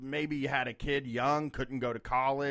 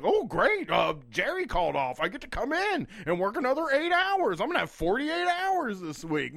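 sarcastic; 9 semitones higher, 3 dB louder than usual. Retold or imitated. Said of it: "they're like".

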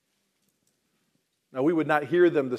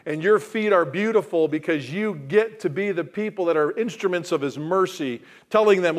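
loud, high, urgent preaching; full-force preaching; 5 semitones higher, 6 dB louder than usual. Acted. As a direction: press the urgency on the listeners. Preacher builds urgent appeal.

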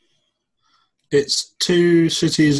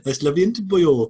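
put-on Welsh accent; 6 semitones higher, 4 dB louder, much faster than usual. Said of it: "best Welsh accent".

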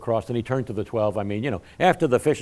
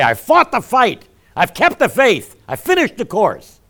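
louder, higher, slow proclamation; triumphant declaration; 10 semitones higher, 9 dB louder than usual. Retold or imitated. Preacher voicing Paul's victory, loud slow proclamation. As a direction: proclaim it like a finished race. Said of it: "where we could say".

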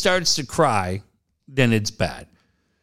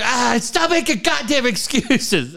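high, drawn-out mock scolding; heated; 10 semitones higher than usual. Venting to an ex about her friend, heated. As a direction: scold in comic exasperation, slow and high. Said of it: "it got me all heated".

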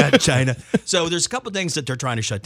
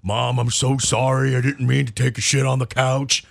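dopey apologetic dog, dragged out; 3 semitones lower than usual. Acted. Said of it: "do you ever do the dog voice".

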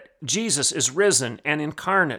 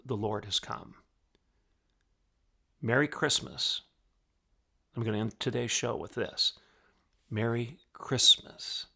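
quiet, low closing words; soft benediction; 6 semitones lower, 8 dB quieter than usual. Acted. Host ending the show with a hushed blessing. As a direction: bless them gently on the way out.

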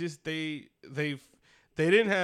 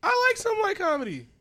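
squeaky mock-earnest confession; jokey high voice admitting a guilty taste; 5 dB louder than usual. Mock voice, big pitch jump.

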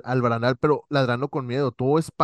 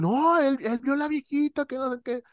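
put-on excited kids telling; 12 semitones higher than usual. Kids retelling to the others.

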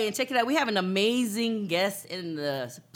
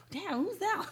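impressed stranger; 10 semitones higher, 6 dB quieter than usual. Retold or imitated. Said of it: "Like, say, like".

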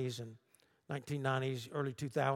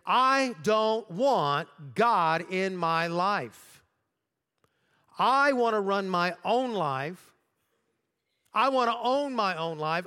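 louder, slower, insisting hard on every line; a pastor acting out her defiance, louder and slower; retold angry outburst; 6 dB louder than usual. Woman refusing God to her psychologist, retold loud and angry. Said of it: "she just got mad about it".

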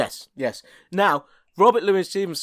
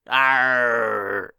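pirate shout; 5 semitones lower than usual. Acted. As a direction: bellow a pirate arr. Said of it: "cyclops pirate".

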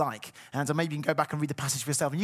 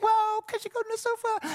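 put-on kids' pleading question. Kids voiced asking.

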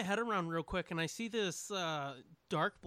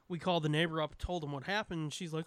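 ordinary talk, nothing marked

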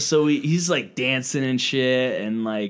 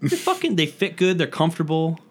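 indignant; 6 semitones higher than usual. Real, not acted. Defending his jeans indignantly.

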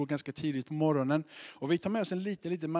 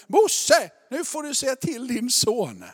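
loud, high-pitched holler; calling out; 8 semitones higher, 11 dB louder, slower than usual. Retold or imitated. Wife hollers to father-in-law about his son, loud scolding. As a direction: imitate an exasperated wife calling for backup. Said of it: "Så hon ropade".

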